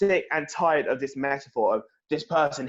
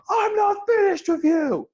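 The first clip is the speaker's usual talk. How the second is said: a much higher, louder shouted threat; Strowman's scream; 5 dB louder than usual. Retold or imitated. Imitating Strowman yelling at a rival, a high, slow shout. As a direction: scream it slowly at the top of your voice like a charging monster. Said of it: "Strowman screaming".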